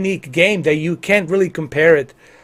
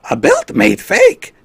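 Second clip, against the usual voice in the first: very high, slow, squeaky Yoda; 4 dB louder, slower than usual. Pretend. Streamer reading the belt slogan as Yoda, very high. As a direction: squeeze it out high and slow like a tiny Jedi. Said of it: "We gotta do this in a Yoda".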